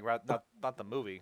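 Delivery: usual speaking voice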